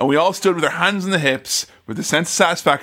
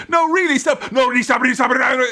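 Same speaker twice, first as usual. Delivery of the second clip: high, pleading mock chant at speed; hammed-up rapid-fire pleading, pitched up; auctioneer patter; 8 semitones higher, faster than usual. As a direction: beg in a relentless auctioneer rattle. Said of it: "It sounds like auctioneers".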